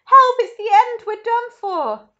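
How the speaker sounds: high, very loud cry for help; panicked shout. A narrator's reading. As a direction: wail that all is lost. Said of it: "Grandpa Joe shouted".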